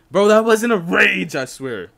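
high, loud, drawn-out objection; loud protest. Real, not acted. Streamer denying to a viewer that he rages, loud protest. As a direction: protest it hard, stretching it out.

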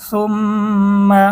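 সুম্মা with long nasal ম্ম; ghunna on mm. Shown as a demonstration. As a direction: hum through the doubled ম. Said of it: "গুন্না করে পড়তে হবে". (ম্ম গুন্না করে)

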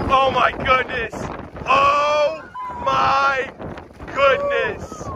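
usual speaking voice

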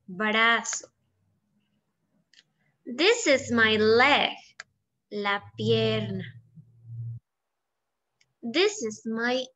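very slow reading, one word at a time; slow model sentences. Shown as a demonstration. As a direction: read each sentence slowly for the pupils to repeat. Teacher reads model sentences slowly.

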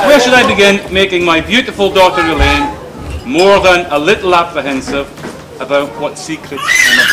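projected out to the room. Real, not acted. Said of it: "Can't hear me".

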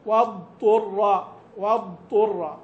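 "واضطر" very slow, ض fully voiced, then ط, no pause, no merging; clear dad before ta. Shown as a demonstration. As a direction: sound out "واضطر" with no pause or assimilation. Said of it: "بلا سكت وبلا إدغام". (نطق الضاد قبل الطاء بلا سكت ولا إدغام)

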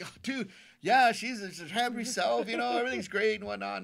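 beam with relief; cat owner gushing with joy; happy gush. Retold or imitated. Cat guy happily reporting.

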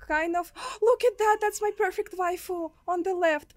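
gasp, then high, loud and excited; excited anime fan. Real, not acted. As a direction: squeal over a favorite character. Sees anime girl on screen.

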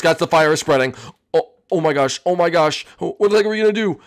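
ordinary talk, nothing marked

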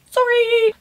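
a sheepish high little sorry; embarrassed high-pitched sorry for rambling; squeaky apology. Real, not acted. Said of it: "wrote my whole dissertation".

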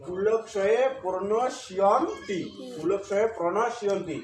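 "কুলক্ষয়ে প্রণশীয়ন্তি" slow and syllable by syllable; pronunciation demo. Shown as a demonstration. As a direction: spell out "কুলক্ষয়ে প্রণশীয়ন্তি" slowly, as kuloksoye pronoshiyonti. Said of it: "তাহলে উচ্চারণ আমাদের কি আসলো?". (ধীরে "কুলক্ষয়ে প্রণশীয়ন্তি" উচ্চারণ)

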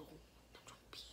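soft whispered aside. Real, not acted. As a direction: breathe it out as a whisper.

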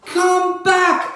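high, loud shout. Acted. Narrator shouting after her fleeing pet, high and loud. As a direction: yell it after him as he runs. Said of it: "I shouted".

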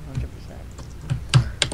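slow whisper. Pretend. Drawn out whisper.

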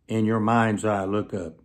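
normal talking voice

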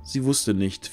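plain reading voice

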